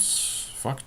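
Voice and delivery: breathy whispered curse under the breath; a whispered fuck; whispered swear. Real, not acted. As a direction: let it slip out as a whisper. Muttered swear over a pick.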